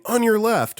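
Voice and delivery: high-pitched trail heads-up; called-out warning. Acted. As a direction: call it out to someone ahead. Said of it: "startling a woman by saying".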